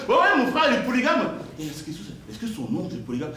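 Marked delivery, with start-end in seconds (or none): 0.1-1.4 loud, high, boastful; cocky yes-yes; proud answer. Retold or imitated. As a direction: brag about it, grinning.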